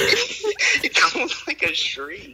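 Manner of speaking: laughing. Real, not acted. Laughing at hiccup sound.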